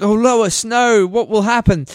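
high, hammed-up wail for Lois; despairing Superman. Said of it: "Superman's all like".

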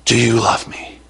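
hushed third asking; tender hushed plea; soft repeat. Retold or imitated. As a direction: let the last do you love me fall to near hush.

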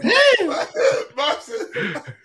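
high-pitched helpless laugh; high burst of laughter. Real, not acted. Host cracking up at the guest's name, high laugh. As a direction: let out a high cackle.

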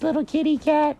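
very high cartoon rabbit voice; high-pitched brush-off; dismissive bunny. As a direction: condescend in a squeaky high register. Mary dismisses Jenkins.

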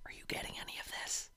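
very soft, quick whisper; hushed, quick whisper while the other keeps reading. Real, not acted. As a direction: sneak the question to your co-reader. Confused aside mid-reading.

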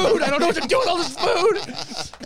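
high, loud frantic wail; panicked. Retold or imitated. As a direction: freak out, voice shooting up. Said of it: "And I was like".

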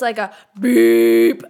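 blaring horn imitation; long loud honk beep. Shown as a demonstration. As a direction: stretch beep out slow and loud like a car horn. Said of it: "not just like a beep".

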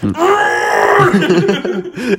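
high-pitched mock scream; comic, high scream of despair; imitated despairing scream. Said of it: "him on the radio just screaming".

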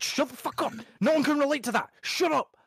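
high, exasperated yelling; fed-up shout, pitched well above normal; exasperated outburst. Retold or imitated. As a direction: yell it up high, totally fed up. Snapping at nationalist grievance talk.

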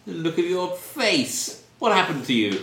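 put-on snooty young Brit. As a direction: look down on the officer. Young Brit insults the officer.

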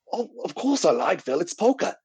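high-pitched, hammed-up Australian accent; a deliberately terrible Australian impression; bad Australian accent. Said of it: "terrible accent there".

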